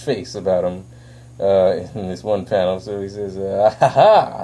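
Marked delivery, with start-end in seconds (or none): 3.8-4.4 theatrical villain laugh. Retold or imitated. Riddler laughs at Batman.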